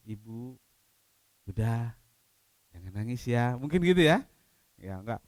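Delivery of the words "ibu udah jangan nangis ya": soft, gentle and much quieter; hushed, caring reassurance; tender comfort. Shown as a demonstration. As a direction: soothe her with a hand on her shoulder. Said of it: "Mungkin dipegang bahunya".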